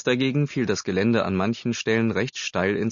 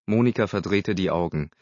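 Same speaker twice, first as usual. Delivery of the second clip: ordinary delivery, nothing marked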